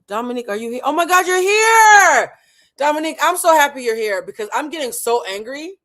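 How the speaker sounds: high, loud, stretched cry of joy; overjoyed shout. Real, not acted. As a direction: shout with delight at her arrival. Guest arrives live.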